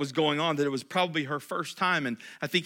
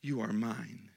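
repeated much softer and lower; soft echo; 5 semitones lower, 8 dB quieter, slower than usual. Shown as a demonstration. Pastor repeating God's words, quiet and tender. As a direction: drop to a hushed repeat. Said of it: "he goes on to say".

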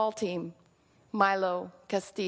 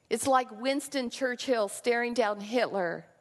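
ordinary delivery

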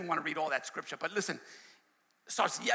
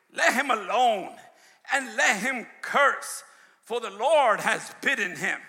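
louder, declaiming King James verse; scripture reading; 3 semitones higher, 6 dB louder than usual. Acted. Preacher declaiming scripture to the church.

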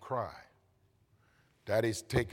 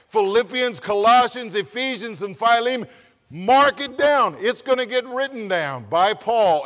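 loud soaring preacher's call; preaching at full force; 10 semitones higher, 11 dB louder than usual. Acted. Preacher rousing the congregation, loud full-force preaching. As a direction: rouse the congregation, booming.

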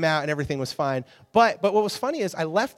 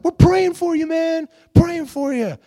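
high, loud shouted reply, slowing on the last words; mock shout; 13 semitones higher, 11 dB louder, slower than usual. Praying guy shouting back at the singer, loud and high. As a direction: call it across the crowd, slowing down at the end.

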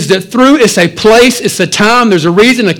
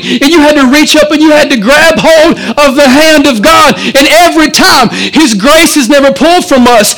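a higher, driving preacher's voice that keeps building; preaching at full force; 6 semitones higher than usual. Acted. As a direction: preach it out to the whole church.

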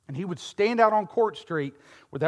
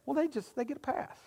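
mock smug voice; 8 semitones higher, 12 dB quieter than usual. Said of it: "And we can say".

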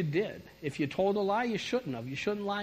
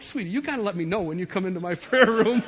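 louder, laughing through each word; loud, laughing "sweetie"; laughing plea; 3 semitones higher, 9 dB louder than usual. Real, not acted. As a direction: gently scold while cracking up. Talking to daughter, laughing.

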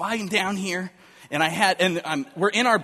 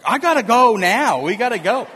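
imitating wife's loud, higher urgent cry; loud, raised-pitch retold insistence; urgent demand; 5 semitones higher, 9 dB louder than usual. Wife in labor tells husband they must leave, urgent.

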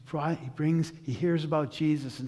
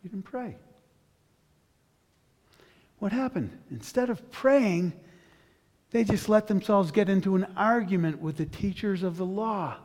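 ordinary talk, nothing marked

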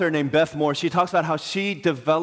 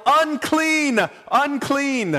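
high, drawn-out cry; lepers' warning yell; 9 semitones higher, much slower than usual. Acted. Lepers warning people who come near, yelling. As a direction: call it out high and long to warn people off. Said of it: "they had to yell".

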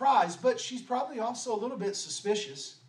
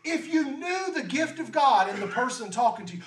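Jesus calling out who he is; bold declaration; 4 semitones higher than usual. Acted. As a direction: proclaim it with full authority. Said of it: "doesn't have an ounce of pride or arrogance".

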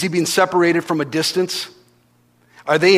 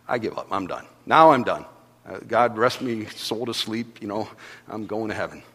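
resigned surrender; 6 semitones lower, 5 dB quieter than usual. Retold or imitated. Giving up while drowning, quote.

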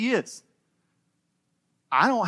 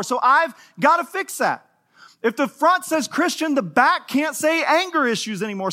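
preaching; 7 semitones higher than usual. Acted. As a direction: drive the point home from the pulpit. Preaching builds.

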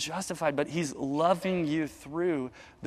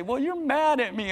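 put-on higher, childlike voice; sulky child; 8 semitones higher than usual. Child answering dad, a hint of a sulky kid's voice. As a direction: lightly play the kid accusing dad.

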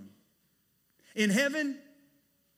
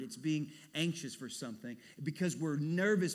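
usual speaking voice

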